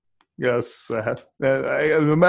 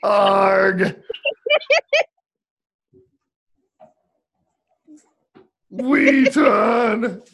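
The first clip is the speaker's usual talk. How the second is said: loud, high, drawn-out groan; hammed-up cry of frustration; mock agonized cry; 6 semitones higher, 5 dB louder, much slower than usual. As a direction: howl as if the joke hurt you. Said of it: "This is what I feel like right now".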